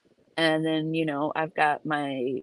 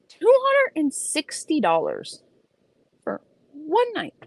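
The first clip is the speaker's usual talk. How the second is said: much higher, stunned disbelief; shocked; 10 semitones higher than usual. Real, not acted. Telling the audience the Hilton price, shocked. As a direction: land the price like a punch.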